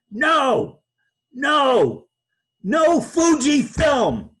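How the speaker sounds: high, loud, drawn-out refusals; mock angry yell. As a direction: imitate a boss yelling into the phone. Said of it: "he faked anger".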